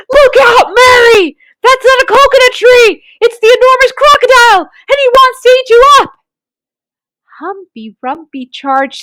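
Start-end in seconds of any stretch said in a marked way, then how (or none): 0.1-6.1 high, loud and fast alarm; urgent yell to the children; shouted warning. A narrator's reading. Humpy Rumpy to Mary, warning her: loud high alarm. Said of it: "shouted Humpy Rumpy".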